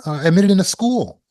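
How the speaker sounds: high, fast, eager rush of news; bursting to share good news; student telling a friend about an admission, thrilled; excited announcement. Retold or imitated. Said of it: "you're excited".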